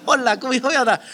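laughing, slipping into Spanish; playful Spanish aside, half laughing; Spanish greeting. Shown as a demonstration. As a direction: toss off a Spanish hello through a laugh. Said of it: "trying to speak Spanish".

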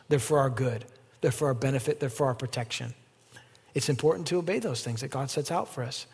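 ordinary talk

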